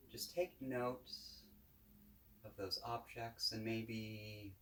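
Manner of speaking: calm, unhurried guided-meditation voice. Real, not acted. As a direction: guide listeners gently, with long pauses between phrases.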